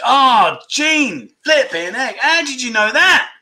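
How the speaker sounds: high, bright burst of disbelief; can't believe someone got it; shocked a viewer guessed it; stunned surprise. Real, not acted. Viewer guesses right instantly.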